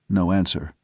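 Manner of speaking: usual speaking voice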